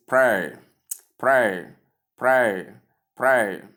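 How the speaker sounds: "prayer" as one syllable, P-R-air; "prayer" in one falling beat, repeated for learners; correct prayer. Shown as a demonstration. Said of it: "the diphthong air".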